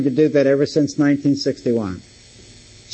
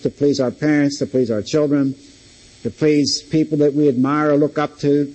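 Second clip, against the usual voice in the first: normal talking voice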